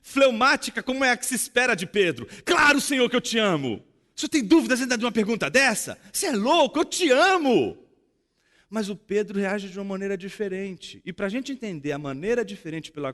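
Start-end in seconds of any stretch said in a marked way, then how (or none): 2.4-7.8 high and loud, Peter blurting out his love; a mock impatient Peter, raised and loud; excited outburst. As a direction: protest it eagerly, almost indignant. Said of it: "uma reação absolutamente fleumática".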